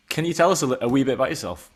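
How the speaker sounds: ordinary delivery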